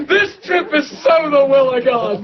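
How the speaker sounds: excited shout. Retold or imitated. Preacher telling the crowd God planned this trip, shouting with joy. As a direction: shout it like the best news ever. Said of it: "I was like".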